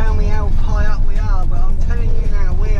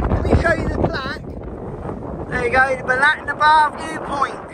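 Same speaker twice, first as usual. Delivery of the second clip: shouting over wind; 7 semitones higher, 7 dB quieter than usual. Real, not acted. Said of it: "if you can hear me over the wind".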